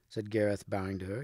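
usual speaking voice

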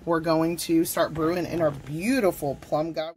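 normal talking voice

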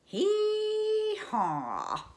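loud high braying hee-haw; a big donkey bray. Shown as a demonstration. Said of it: "you gotta do a big".

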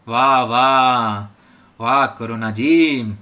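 low, slow sarcastic praise; sarcastic wah. Acted. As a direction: drag out a mock compliment.

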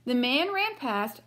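lively read-aloud storybook voice; reading to children. A narrator's reading. Teacher reading a picture book to the class, lively. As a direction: read it brightly for young listeners.

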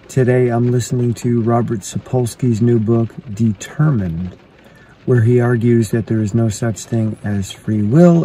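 normal talking voice

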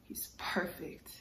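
hushed, voiceless whisper. Acted. God whispers to the angel about Noah. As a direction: breathe it like a secret.

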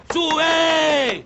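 boarding call. Shown as a demonstration.

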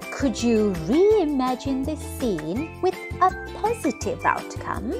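ordinary delivery

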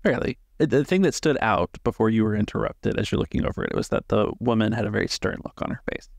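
ordinary delivery

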